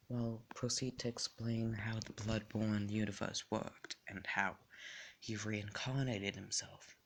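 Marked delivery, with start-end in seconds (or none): none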